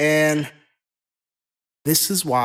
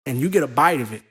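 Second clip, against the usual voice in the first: ordinary delivery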